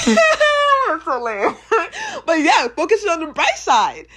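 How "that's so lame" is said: high, loud laugh through the words. Real, not acted. Laughing at her own cheesy heart voice. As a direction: crack up at yourself.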